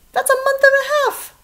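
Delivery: high, loud, fast disbelief; surprised. Real, not acted. Teacher reacting to Toad's long nap, high and loud. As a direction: make it sound outrageous.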